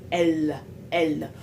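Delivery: L said as a full clear French L; tongue taps the palate on L; normal French L. Shown as a demonstration. Showing learners the normal L, clear and tapped. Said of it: "Le L normal, c'est L, comme en français".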